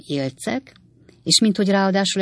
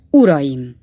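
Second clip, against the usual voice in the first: loud call to the crowd; mayor hailing the voters loudly; booming address; 6 dB louder than usual. A narrator's reading. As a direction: bellow Uraim to open the speech. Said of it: "olyan harsány hangon".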